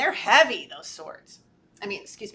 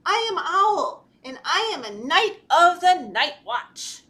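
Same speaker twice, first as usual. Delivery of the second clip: high, loud, proud little knight; puffed-up brave Owl; 5 semitones higher, 6 dB louder than usual. A narrator's reading. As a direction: declare it with puffed-up courage. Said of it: "he puffed his feathers and he said".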